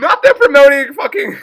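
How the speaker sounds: high, loud, collapsing into laughter; laughing shriek. Real, not acted. Viewer cracking up at Devin being promoted, laughing shriek. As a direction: squeal through uncontrollable giggles.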